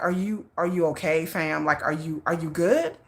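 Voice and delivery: low, softened checking-in; quiet concern. Retold or imitated. Asking an agitated friend if they are okay, low and concerned. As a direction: carefully ask after someone, voice dropped.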